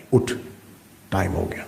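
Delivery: low, soft inner nudge to wake; hushed inner wake-up call. Acted. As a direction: murmur it low, like a thought prodding a sleeper. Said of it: "پہلی آواز اندر سے آئی".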